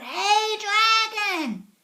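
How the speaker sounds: high, loud yell; shout. Acted. Elizabeth yelling into the dragon's ear. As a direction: yell right into his ear. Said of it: "shouted as loud as she could".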